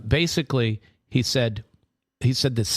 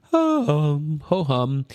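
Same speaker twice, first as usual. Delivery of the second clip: mock yawn; 5 semitones higher, 4 dB louder, slower than usual. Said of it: "sorry to yawn over that".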